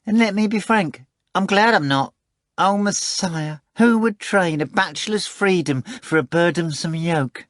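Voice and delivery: a fat cat bragging and wheedling; boastful cat Behemoth. Acted. Behemoth swearing to Voland, high wheedling cat voice.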